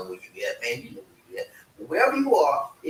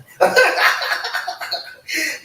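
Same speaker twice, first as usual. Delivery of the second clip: high breathy laugh; burst of laughter; 13 semitones higher, 6 dB louder than usual. Real, not acted. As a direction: laugh out loud at your own joke. Laughing at fifth grade joke.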